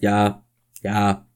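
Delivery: ordinary delivery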